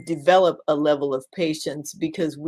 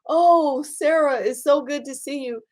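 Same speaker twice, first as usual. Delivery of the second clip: high, bright-eyed relief at seeing a friend; hospital patient glad to see her friend, happy and high; cheerful patient greeting; 9 semitones higher, 3 dB louder than usual. Acted. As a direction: gush happily, much higher than usual. Patient greets friend a third time.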